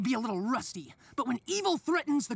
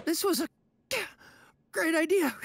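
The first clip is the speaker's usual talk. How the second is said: halting, less excited praise; strained fake approval; 3 semitones higher than usual. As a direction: claim approval while hurting inside. Regrets the idea after kiss.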